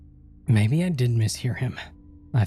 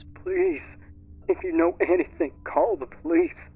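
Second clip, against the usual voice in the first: higher, sobbing plea; halting sobs; 9 semitones higher than usual. Acted. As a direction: beg through tears again. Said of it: "his voice returned to a halting sobs".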